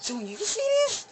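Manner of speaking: high, hyped-up asking; nudging his friend, excited; excited cousin. Retold or imitated. Cousin excitedly pointing girls out.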